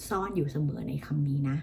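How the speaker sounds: ordinary talk, nothing marked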